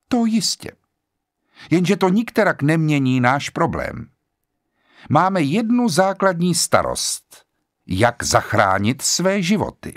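a hint of an authoritative old judge. A narrator's reading. Judge voiced in story.

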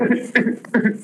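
short unvoiced laugh breaking out; a quick airy laugh at the joke; breathy laugh. Real, not acted. Comic haggling over the beheading.

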